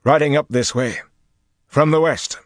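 high, loud lookout's report; sudden alert. A narrator's reading. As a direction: call out where they're coming from. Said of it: "Flynn said suddenly".

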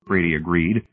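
plain reading voice

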